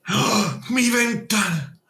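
high, drawn-out mock dismay; mock shock. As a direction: gasp as if the window were precious. Mock alarm over his window. (sobresalto fingido, agudo y alargado)

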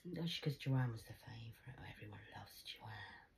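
whispered, resentful aside. Real, not acted. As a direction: mutter it under your breath. Bitter aside about Joanne.

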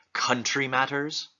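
"country" with a pointed first syllable; stress on first syllable. Acted. Hamlet teasing Ophelia with innuendo, first syllable of "country" stressed. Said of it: "With a certain emphasis on the first syllable of the word country".

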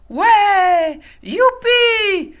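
high, loud cheering cubs; high shrieking wolf cubs. A narrator's reading. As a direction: shout it like a pack of excited wolf cubs. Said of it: "hurlèrent les louveteaux".

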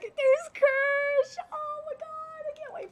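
very high, loud fangirl shriek; ecstatic scream. Real, not acted. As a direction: scream out at spotting a favourite. Fan spots a favourite character.

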